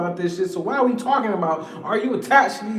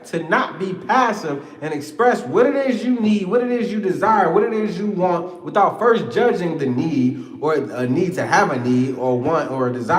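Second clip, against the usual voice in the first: ordinary talk, nothing marked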